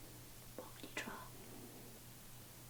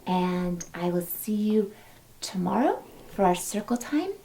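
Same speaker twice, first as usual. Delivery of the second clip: usual speaking voice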